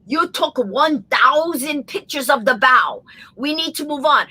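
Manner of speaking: exasperated imitation of a Russian pilot; Russian accent. Russian pilot elbowing the Japanese passenger, exasperated Russian accent. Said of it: "the heavy Russian accent".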